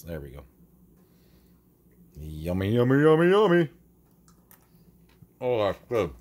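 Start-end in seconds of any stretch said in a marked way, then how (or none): 2.2-3.7 rising, louder and higher yummy chant; sing-song yummy. Real, not acted. Tasting his breakfast. 5.4-6.1 talking with mouth full. Real, not acted. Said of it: "Don't talk with your mouth full".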